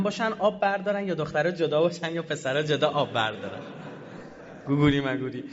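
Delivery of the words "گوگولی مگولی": a giggly, sarcastic pet-name coo; laughing mock cutesy. Real, not acted. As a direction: coo it mockingly while laughing. Mocking cute nickname, laughing.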